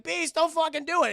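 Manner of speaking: high, loud imitated woman calling out; mock urgent plea. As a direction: cry out to stop him, hammed-up. Belle calls out to Beast.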